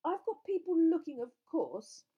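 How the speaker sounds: high, loud defensive answer; strained. A narrator's reading. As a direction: insist loudly with strain in the voice. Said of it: "looking strained again".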